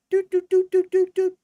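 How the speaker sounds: high, loud mechanical doots mimicking a till; beeping register. Cashier punching in the senior discount, mock beeping. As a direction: beep it out like a cash register keypad.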